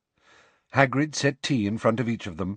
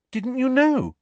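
surprised; 4 dB louder than usual. A narrator's reading. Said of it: "wide-eyed".

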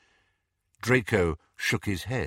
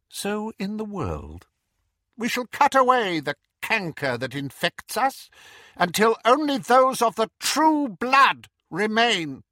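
high, cold, rising to louder fervor; Voldemort voice; 12 semitones higher, 4 dB louder than usual. Acted. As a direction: build the menace to the end. Voldemort's pureblood speech.

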